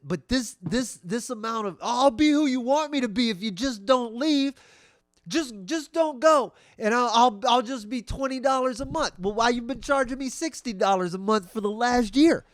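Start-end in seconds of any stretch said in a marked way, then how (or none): 1.8-9.2 high, loud clingy pleading; hammed-up needy bargaining; begging. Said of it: "they beg you to". 9.2-12.4 raised, loud indignant complaint; mock quarrel. Acted quarrel over being overcharged.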